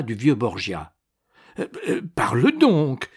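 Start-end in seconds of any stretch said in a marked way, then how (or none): 1.6-3.1 much higher, hesitant anxious plea; anguished. A narrator's reading. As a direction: stumble in, then urge anxiously. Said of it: "dit-il avec angoisse". (supplication angoissée)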